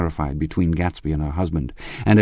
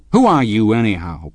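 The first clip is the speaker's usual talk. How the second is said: loud, raised challenge, falling away; angry outburst; 4 semitones higher, 6 dB louder than usual. A narrator's reading. Tom challenges Gatsby, breaking out angrily. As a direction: burst out contemptuously at a rival. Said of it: "broke out Tom".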